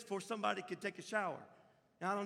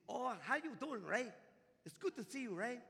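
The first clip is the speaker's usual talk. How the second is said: put-on light Hungarian accent, a little higher; 6 semitones higher than usual. Hungarian neighbour Rosie greeting young Ray, light Hungarian accent. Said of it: "She had a little accent".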